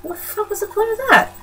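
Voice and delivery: mock outraged confusion; baffled disbelief. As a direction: squeak out the disbelief. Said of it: "And I'm like".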